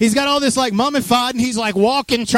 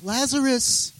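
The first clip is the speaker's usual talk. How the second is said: softly voiced, tentative call; casual, timid request; 3 semitones higher, 6 dB quieter, slower than usual. Preacher showing how Jesus didn't call, mild and hesitant. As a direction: call him as if asking a favor. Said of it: "He wasn't like".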